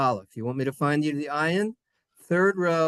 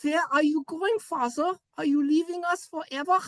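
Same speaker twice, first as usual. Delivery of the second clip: put-on high little-child voice, German accent; plaintive German child; 13 semitones higher than usual. Child asking departing Father, high German-accented plea.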